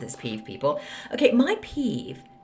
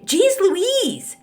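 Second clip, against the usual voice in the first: high and louder jeez Louise; exasperated; 10 semitones higher, 4 dB louder, slower than usual. Real, not acted. Podcaster to complaining listeners, high exasperated. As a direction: throw it up high, fed up.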